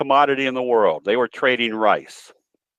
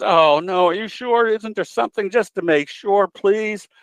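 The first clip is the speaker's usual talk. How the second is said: higher, begging lady voice; pleading lady; 7 semitones higher than usual. Retold or imitated. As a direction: beg the vet in a lifted lady voice. Joke: lady begs again.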